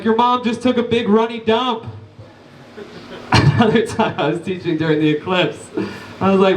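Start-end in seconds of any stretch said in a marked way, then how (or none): 0.0-1.8 higher-pitched mock schoolkid jeer; lightly voiced kid delivering a roast. Kid roasting the teacher during an earthquake, high mock jeer. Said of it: "Some kid was like".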